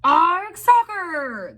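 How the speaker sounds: very high, loud pirate chorus; crew yell. A narrator's reading. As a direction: yell it back as the whole crew. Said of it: "the crew yelled".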